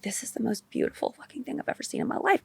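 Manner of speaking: hushed, breathless whisper of wonder; awed whisper. Retold or imitated. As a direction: whisper it like you can't believe your eyes. Awed inner reaction, whispered.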